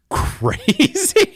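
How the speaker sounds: slow, high-pitched amazement; drawn-out disbelief. Real, not acted. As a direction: stretch it out in disbelief. Reacting to an AI story voiceover.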